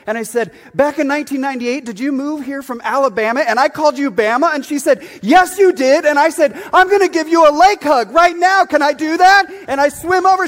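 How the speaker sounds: high, loud, breathless-with-joy retelling, building to a shout; excited reunion. Retold or imitated.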